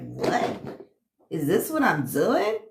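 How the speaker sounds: low what, then a puzzled rising question; startled realization, lightly acted; mock shocked viewer. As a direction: play the stunned viewer catching herself.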